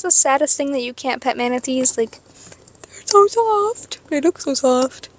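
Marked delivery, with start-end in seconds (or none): 2.2-5.1 high, drawn-out coo; cooing longing. Real, not acted. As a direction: coo slowly over something adorable. Cooing over untouchable manatees.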